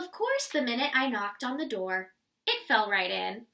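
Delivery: usual speaking voice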